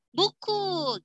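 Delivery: high answer; student answer. Retold or imitated. Student answering the teacher in a high voice. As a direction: call it out quickly. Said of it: "student answer".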